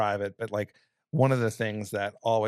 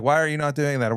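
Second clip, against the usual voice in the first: louder, higher, impatient controller; mock scolding; 5 semitones higher, 6 dB louder than usual. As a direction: scold the pilot like a fed-up controller.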